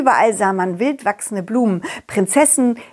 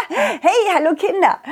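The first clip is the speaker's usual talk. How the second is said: laughing greeting; 8 semitones higher than usual. Acted. Puppet greets children laughing.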